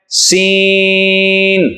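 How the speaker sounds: سین drawn out very slowly, with a hiss; سین held extra long, whistling s; long seen. Shown as a demonstration. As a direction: stretch سین long and sharp. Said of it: "لمبا کر کے پڑھیں گے".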